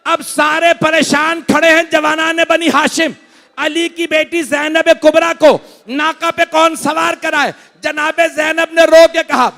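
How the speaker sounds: high, loud, slowed cries of grief; wailing lament. Acted. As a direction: preach the tragedy at full force, wailing. Climax of the masaib recitation.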